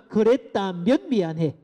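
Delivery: slow, stressed "그랬다면 미안해"; slow demo apology. Shown as a demonstration. Lecturer modelling a conditional apology slowly. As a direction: lean on 그랬다면, unhurried. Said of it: "따라해 봅니다".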